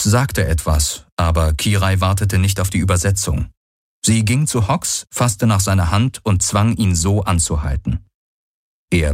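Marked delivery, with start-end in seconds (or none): none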